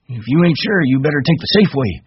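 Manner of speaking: put-on high-pitched old man, insistent; high, pressing old ranch hand; Candy, urging.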